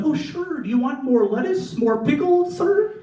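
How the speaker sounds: high, sugary customer-service voice; overly sweet server. Retold or imitated. Comic mimicking a California Subway worker, high and sweet. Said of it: "they'll be like".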